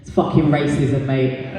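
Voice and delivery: ordinary talk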